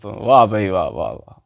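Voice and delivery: low, hushed mock admiration; sarcastic praise. Real, not acted. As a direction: drop low and soft, fading off in ironic applause. Sarcastic applause for the errors.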